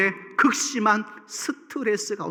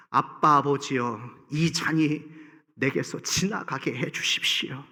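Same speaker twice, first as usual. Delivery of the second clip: low broken plea; 7 semitones lower, 5 dB quieter than usual. Acted. Voicing Jesus, low and soft.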